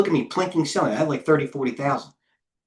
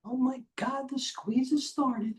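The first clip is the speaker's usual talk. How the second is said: excited gasp; 10 semitones higher, 7 dB quieter, slower than usual. Real, not acted. Squeeze starts on a stock.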